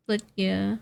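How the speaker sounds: normal talking voice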